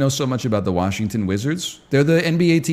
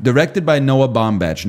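ordinary talk

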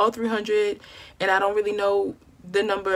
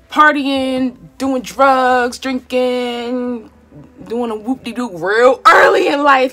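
loud, higher and near crying; impassioned outcry; 5 semitones higher, 9 dB louder, much slower than usual. Real, not acted. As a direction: cry it out with real feeling. Impassioned about what could have been.

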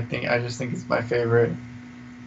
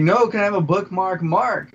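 higher, insistent repeat; mild mock yelling; 7 semitones higher than usual. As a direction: press the request as if nobody understood. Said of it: "Why are you yelling?".

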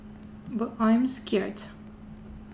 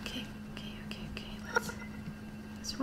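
whisper; 13 semitones higher, 17 dB quieter, much faster than usual. Real, not acted. Hiding from monster in game.

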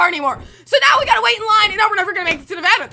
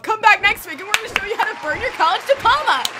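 lower, host sign-off voice; mock TV show outro; 5 semitones lower than usual. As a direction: wrap it up like a cooking show host.

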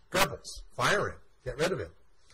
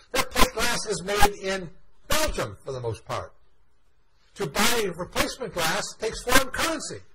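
higher and louder, an outraged retold rant; retold furious tirade; 6 semitones higher, 4 dB louder than usual. Goering berating Goebbels at the meeting, furious shouting. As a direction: berate him like a boss who's lost it. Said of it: "express his absolute fury at Goebbels".

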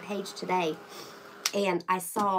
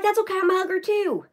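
high, loud and delighted; gushing reply; 11 semitones higher, 6 dB louder than usual. Retold or imitated. Telling viewers how she answered a churchgoer, high and loud. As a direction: squeal it back like you're thrilled to meet a fellow hugger. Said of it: "I was like".

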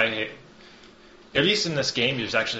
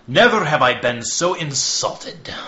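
loud, higher, affronted outburst; annoyed nobleman; 4 semitones higher, 8 dB louder than usual. Acted. Annoyed man storms into the council chamber, loudly indignant. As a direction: burst in loudly with wounded indignation. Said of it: "He seems rather annoyed".

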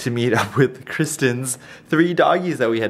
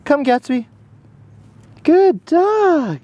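excited praise; 13 semitones higher than usual. Real, not acted. Owner cheering her puppy on the grass, high and excited.

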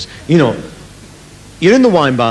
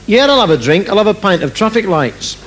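put-on brash girl at the bar; 9 semitones higher than usual. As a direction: shout back like a blunt barmaid. Girl orders a drink.

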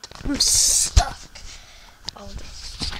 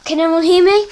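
loud, high call for help; calling down from tower; 7 semitones higher, 14 dB louder than usual. Real, not acted. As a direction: shout down to someone far below.

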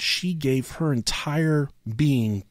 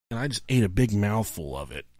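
ordinary talk

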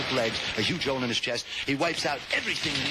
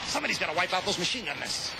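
higher movie-hero voice; heroic soldier; 6 semitones higher, faster than usual. Acted. Sonny Tufts volunteering in the landing craft, hammed hero. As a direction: play the brave GI. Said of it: "Sonny Tufts says".

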